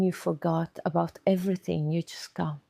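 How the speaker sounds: usual speaking voice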